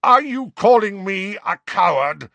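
furious challenge; roared. A narrator's reading. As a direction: Sirius roaring at Snape. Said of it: "roared Sirius".

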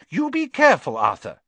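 high, loud sharp warning. A narrator's reading. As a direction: snap a warning at her husband. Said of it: "said Missus Weasley sharply".

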